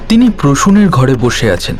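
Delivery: ordinary talk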